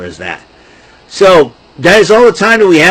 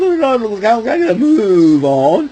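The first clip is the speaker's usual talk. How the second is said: higher, slow put-on Muppet voice; Muppet frog voice; 7 semitones higher, slower than usual. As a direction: fret like a flustered Muppet. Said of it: "He goes".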